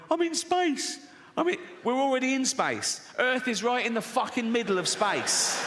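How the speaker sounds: high-pitched, hammed-up amazement; mock-excited space tourist. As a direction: crow it high like a thrilled tourist.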